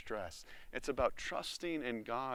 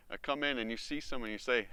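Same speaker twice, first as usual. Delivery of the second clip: normal talking voice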